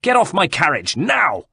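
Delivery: high, urgent order; shouting. A narrator's reading. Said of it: "Checo himself was shouting".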